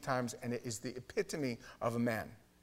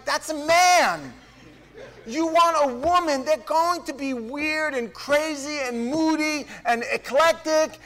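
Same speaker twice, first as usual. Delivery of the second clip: building from raised to full shouting rant; escalating rant; 13 semitones higher, 13 dB louder, slower than usual. Retold or imitated. Telling his friend Cliff off, climbing to a shout. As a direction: lecture him louder and louder until yelling. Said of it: "And I said".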